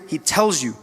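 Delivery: ordinary delivery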